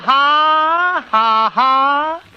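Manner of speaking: high, held-out comic "ha, ha"; put-on vaudeville laugh, far above speaking pitch; high sustained stagey laugh. Shown as a demonstration. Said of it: "take [name] again".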